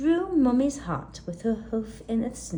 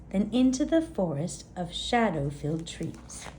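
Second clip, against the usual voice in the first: plain reading voice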